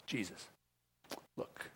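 hushed, confidential aside; low-key murmur, as if only one listener should hear; Peter. Acted. Peter privately assuring Jesus he will stay, hushed confidential tone. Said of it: "By the way, it wasn't a whisper".

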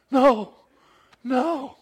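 loud, high anguished no; dismayed no. Retold or imitated. Hungry preacher finding the truck closed, loud high dismay. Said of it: "I'm like".

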